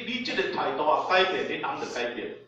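ordinary delivery

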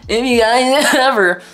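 talking through coughs; choking on smoke between words; coughing fit. Real, not acted. Coughing after smoking.

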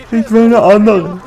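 sulking about his bad weapon; whiny repeat. Shown as a demonstration. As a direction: whine it like a spoiled kid.

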